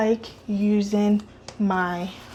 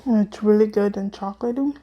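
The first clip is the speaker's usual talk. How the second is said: mouth full; 3 dB quieter than usual. Real, not acted. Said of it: "I'm talking with my mouth full".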